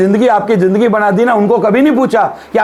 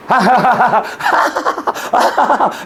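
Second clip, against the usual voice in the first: laughing demo. Shown as a demonstration. Demonstrating the laughing sound.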